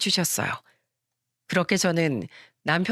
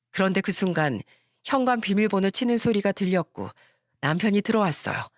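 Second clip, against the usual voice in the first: normal talking voice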